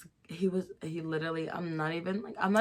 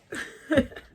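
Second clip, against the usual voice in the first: giggle; 11 semitones higher, 5 dB louder than usual. Real, not acted. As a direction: let out a tipsy giggle. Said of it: "super giggly".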